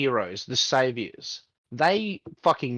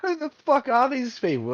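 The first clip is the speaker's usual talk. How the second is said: incredulous outburst; 12 semitones higher than usual. Retold or imitated. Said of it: "And it's like".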